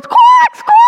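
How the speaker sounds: loud, very high squawks; sharp high bird squawk; imitated screaming birds. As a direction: screech out like birds being poked. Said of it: "They're screaming".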